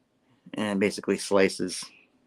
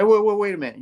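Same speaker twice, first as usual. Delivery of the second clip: high, louder mock surprise; taken aback; 11 semitones higher, 5 dB louder, faster than usual. Slide hearing of two Spider-Men, acted surprise. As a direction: break in startled, pitch jumping up.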